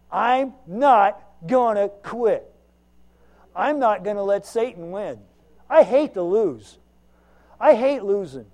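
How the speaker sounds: loud, slow, defiant sermon lines; stubborn preaching. Acted. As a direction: dig in and hammer each word. Preaching at full force.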